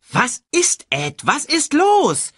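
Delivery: high, irritated screech; very high, loud demand. A narrator's reading. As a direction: screech the question, drawing out the end. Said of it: "kreischte Shenzi".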